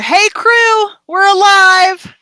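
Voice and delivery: high, loud, drawn-out yell. Acted. Calling to the crew through the door, yelling. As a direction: call out as if through a thick door. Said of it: "She's just yelling, ... through the door".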